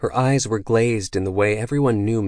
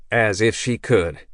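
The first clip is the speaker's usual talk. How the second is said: ordinary delivery, nothing marked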